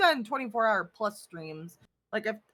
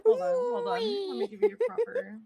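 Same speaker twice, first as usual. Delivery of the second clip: excited; 9 semitones higher than usual. Acted. Starts a performed greeting.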